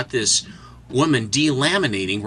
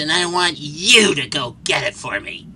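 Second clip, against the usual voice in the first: demanding madwoman; 5 semitones higher than usual. Retold or imitated.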